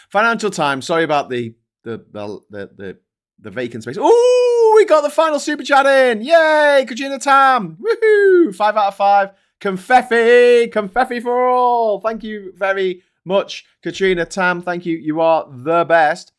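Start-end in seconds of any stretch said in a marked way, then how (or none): 4.0-12.0 big high squeals of delight; whooping thanks for a donation; excited cheering. Real, not acted. Cheering final super chat. 12.0-16.3 warm thanks. Real, not acted. Thanking donor.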